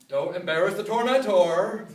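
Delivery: loud, punchy warning; emphatic warning. Real, not acted. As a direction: drive the lesson home loudly.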